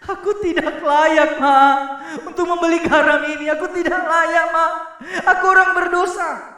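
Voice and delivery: high, loud hammed-up wail of unworthiness; mock sobbing child. Child wails to mom he is unworthy, hammed-up loud cry. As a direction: wail it like a melodramatic kid. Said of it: "dia nangis-nangis".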